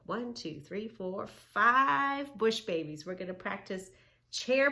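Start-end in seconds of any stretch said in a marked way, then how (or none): 1.3-3.0 slow, loud final count; counting finale. Shown as a demonstration. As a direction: finish the count with a flourish. Counting to five with kids.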